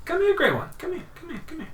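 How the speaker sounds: high coaxing little voice, calling him over; high gnome coaxing the grey one closer; beckoning gnome. Acted. Gnome beckons the grey player over, high and coaxing. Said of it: "he kind of beckons you over".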